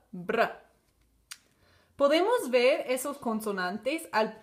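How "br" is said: br said as one blend, B straight into a tapped R; br sound. Shown as a demonstration. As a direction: join B and R in br. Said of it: "B, R". (pronuncia br uniendo la be y la erre)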